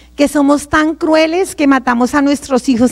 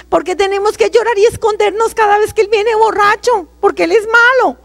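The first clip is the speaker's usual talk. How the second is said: high, pleading protest, reenacted; child's protest; 8 semitones higher than usual. Older daughter questioning mamá about drunk papá, pleading protest. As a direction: protest like an upset daughter, pitched way up.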